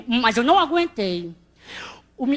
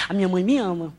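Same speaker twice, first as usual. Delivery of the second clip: ordinary delivery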